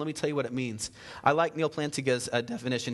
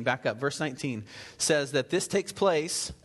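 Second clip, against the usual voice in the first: usual speaking voice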